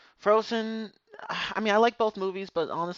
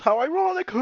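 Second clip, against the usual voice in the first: high, loud sarcastic quip; 11 semitones higher, 8 dB louder than usual. Real, not acted. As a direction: drip with irony. Sarcastic about the cold.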